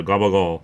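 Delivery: "gabagool" with g sounds and dropped ending, "gah-bah-GOOL"; local Italian slang. Shown as a demonstration. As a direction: say "gabagool" the Rhode Island Italian way. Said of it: "you're Italian around here".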